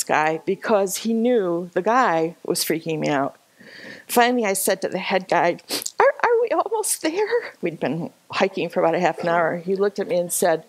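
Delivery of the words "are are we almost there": very high, stammered mock plea; retold anxious question. Tired hiker to head guide, imitated pleading. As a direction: squeak it hopefully, stumbling on the first word.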